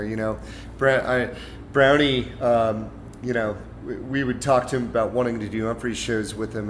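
ordinary delivery

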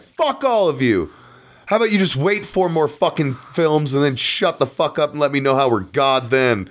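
hammed-up fury at the fans; mock angry rant. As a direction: pretend press conference rant, high and scornful. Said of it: "And I'd be like".

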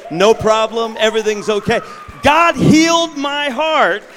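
high, loud, triumphant preaching shout; preaching at full force. Acted. Preacher proclaiming his healing to the congregation. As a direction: ring it out in celebration.

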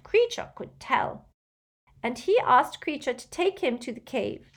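high-pitched elf voice telling a troubled tale; distressed old house-elf. Acted. Elf recounts his master's strange night, distressed elf voice.

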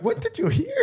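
very high, imitated incredulous laughter; retold laughing question. Boyfriend imitates his girlfriend laughing at what he misheard. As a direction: squeal it through mock laughter. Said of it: "explodes laughing".

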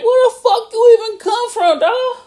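very high, loud mock bewilderment; incredulous outburst. Man facing a zombie mammoth, high incredulous yelp. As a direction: squawk it in disbelief, pitch way up.

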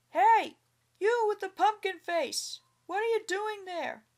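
loud, high angry shout; exploding shout. A narrator's reading. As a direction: yell it across the garden. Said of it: "So he called out".

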